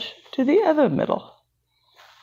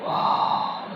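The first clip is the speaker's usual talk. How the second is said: awed whisper; 6 dB quieter than usual. Acted. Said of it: "says the boy, so excited".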